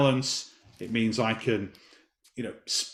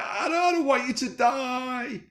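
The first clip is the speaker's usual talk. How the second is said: put-on much higher, slow wailing cry of a small boy; sobbing child; 13 semitones higher, slower than usual. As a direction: imitate a child bawling the words out. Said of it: "He's crying his eyes out".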